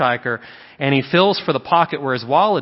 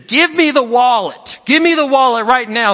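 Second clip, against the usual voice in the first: furious demand; 9 semitones higher, 5 dB louder than usual. Retold or imitated. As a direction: yell it like you've just been robbed. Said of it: "He says".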